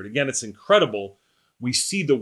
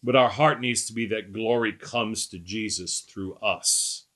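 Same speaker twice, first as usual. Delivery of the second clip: ordinary talk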